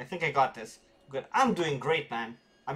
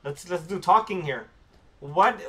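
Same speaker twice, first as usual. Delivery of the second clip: normal talking voice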